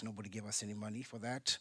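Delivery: normal talking voice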